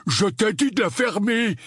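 high, irritated loutish voice; angry retort. Acted. Dudley snaps at teasing cousin. (réplique furieuse de petite brute, très aiguë)

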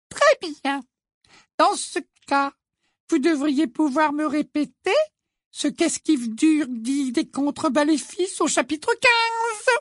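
high, slow, falsely sweet teacher; a sickly-sweet strict witch; Umbridge voice. A narrator's reading. Ombrage voiced by narrator. (voix haute et mielleuse)

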